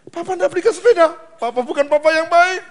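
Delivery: put-on high, loud bratty child wail; whining child. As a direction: wail like a spoiled kid. Whining child blaming father. (rengekan anak manja, tinggi dan keras)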